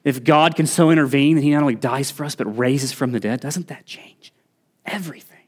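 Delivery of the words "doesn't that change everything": dropped to a hushed, intimate hush; hushed repeat. Real, not acted. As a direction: let the question sink in quietly. Same question repeated, dropped to near-whisper.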